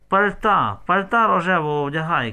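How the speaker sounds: loud, high urgent order; shouting. A narrator's reading. Mother hurries daughter into her coat, shouting. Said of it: "кричить мамка".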